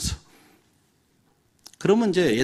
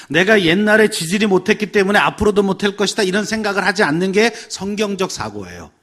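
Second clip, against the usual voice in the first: loud, higher-pitched declaration; emphatic preaching; 7 semitones higher, 7 dB louder than usual. Real, not acted. Preacher teaches congregation not to judge by past, emphatic. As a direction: drive the point home forcefully. Preacher drives home the main point.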